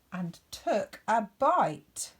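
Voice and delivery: usual speaking voice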